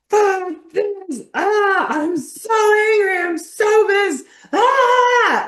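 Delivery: full-out rage. Shown as a demonstration. Coach demonstrating rage to a client, screaming. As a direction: let the anger rip out at full force. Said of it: "this is like".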